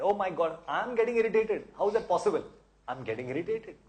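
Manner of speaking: hammed-up astonishment, half laughing at yourself; mock surprise. Shown as a demonstration. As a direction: act shocked at your own irritation. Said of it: "Or you tell yourself in the mind".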